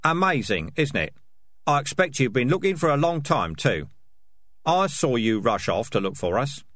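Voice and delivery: pointed and disbelieving; nasty sarcasm. Acted. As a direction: lay the sarcasm on. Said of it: "a nasty tone of voice".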